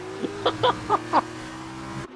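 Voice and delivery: high breathless laughing fit. Real, not acted. Commentator laughing at his own miscount, high giggle. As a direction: crack up helplessly at yourself.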